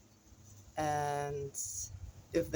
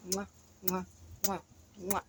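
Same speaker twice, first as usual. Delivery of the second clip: blowing kisses; 3 semitones higher, 5 dB quieter than usual. Real, not acted. Blowing kisses to viewers goodbye.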